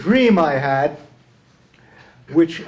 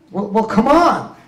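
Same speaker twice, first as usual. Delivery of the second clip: higher, louder exasperated prodding; acted impatience; 7 semitones higher, 7 dB louder than usual. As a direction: hurry them along, playing at losing patience. Acts genie losing patience with dreamer.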